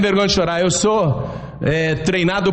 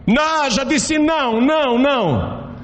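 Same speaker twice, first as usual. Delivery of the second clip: higher, mock-stern no to a begging child; mock tough refusal; 9 semitones higher than usual. Parent pretending to be tough with child, mock-stern higher. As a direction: play the hard-nosed parent, half in jest. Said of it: "dar uma de durão".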